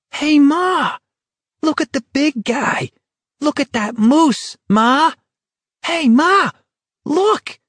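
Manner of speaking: put-on high, shrill child yelling; excited kid screaming to his mom; little boy shouting. A narrator's reading. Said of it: "A little boy was screaming lustily".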